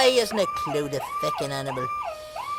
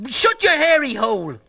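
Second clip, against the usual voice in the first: loud, higher, indignant telling-off; angry outburst; 3 semitones higher, 4 dB louder than usual. Acted. Embarrassed man to his teasing friend, angry outburst. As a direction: shut him up hard. Snaps at teasing about the video.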